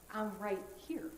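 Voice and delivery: soft, hushed reply as Jesus; gentle reassurance. Acted. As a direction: quietly reassure a searching soul.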